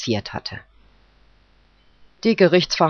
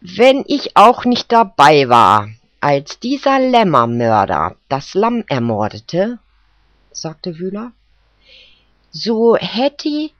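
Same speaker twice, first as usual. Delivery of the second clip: sly fox witness; 3 semitones higher, 5 dB louder than usual. Acted. Fox witness Wühler testifies.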